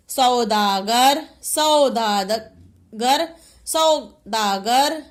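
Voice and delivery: सौदागर drawn out syllable by syllable; slow teacher's sounding-out of सौदागर; sounded out. Shown as a demonstration. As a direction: stretch the औ in सौदागर very slowly. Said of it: "किसकी sound आ रही है".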